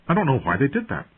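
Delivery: unsettled, lifted pitch; shaken young lord. A narrator's reading. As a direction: admit it, rattled. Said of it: "Even Robb looked shaken".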